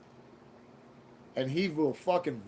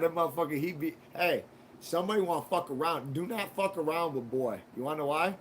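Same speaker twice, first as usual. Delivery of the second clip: ordinary talk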